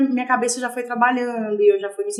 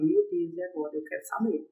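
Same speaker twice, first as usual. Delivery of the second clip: murmura aflita, bem mais baixo; aflição baixinha; 11 dB quieter than usual. Retold or imitated. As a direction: quase para si mesma, ansiosa e contida. Médico viajando, ela aflita. (sem o médico, desabafando baixinho e aflita)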